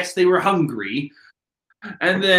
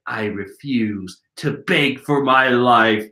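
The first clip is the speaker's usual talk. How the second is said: slow, lower, measured refusal; defiant captive; 7 semitones lower than usual. Acted. Captive refusing to beg, slow low acted voice. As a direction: declare it slowly and firmly in character. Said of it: "Korasaki's like".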